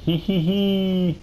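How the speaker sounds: very high mock giggle; squeaky giggle.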